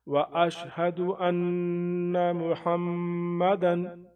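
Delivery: ان with the doubled n held nasally, slow; nasal hold. Shown as a demonstration. Teacher shows the held doubled noon. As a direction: hold the نّ in ان before Mohammedan. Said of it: "یہاں رکنے کی کوشش کریں".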